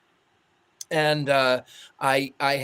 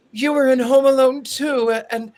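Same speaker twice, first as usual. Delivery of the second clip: loud, high-pitched gushing; acted-out excited fan praise; 9 semitones higher, 6 dB louder than usual. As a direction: gush to a movie star you've just recognised. Friend gushes at famous actor.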